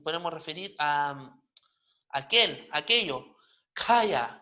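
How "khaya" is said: "kaya" with a puff after the K; aspirated K. Shown as a demonstration. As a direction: release the K of "kaya" with loud breath. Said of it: "no es simple".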